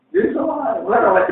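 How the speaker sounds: high, tearful break on the words; crying voice. Real, not acted. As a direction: let the voice crack with tears. Preacher's voice cracks, high.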